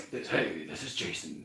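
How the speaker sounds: low, soft announcer voice; hushed late-night radio host. Pretend. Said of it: "is this your radio voice?".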